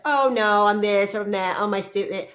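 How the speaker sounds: mimicking how she used to reject compliments, whiny; mock self-put-down. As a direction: parody her old self-deprecation. Mocking old self-talk.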